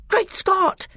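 high startled cry of relief. A narrator's reading. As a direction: gasp it out in amazement. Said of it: "cried Edmund".

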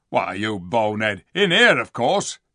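rude dwarf voice rising loud; scornful dwarf. A narrator's reading. Diggle answering Edmund's question, scornful. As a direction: scold a fool impatiently.

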